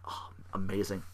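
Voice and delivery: breathy hushed whisper of amazement; awed whisper. Real, not acted. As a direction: whisper it in awe.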